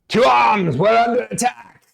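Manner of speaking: shrieking warrior cry, high and loud; high shrill alarm call. Acted. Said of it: "she's like".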